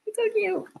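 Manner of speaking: very high cooing squeak; squealing cute. Real, not acted. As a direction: coo it high like to a puppy. Dog dreaming, repeated cooing.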